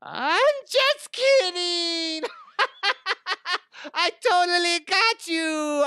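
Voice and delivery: very high, loud, giddy squeal; high-pitched laughing goofball; prank caller cracking up. Acted. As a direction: shriek it with drawn-out glee. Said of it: "I was like".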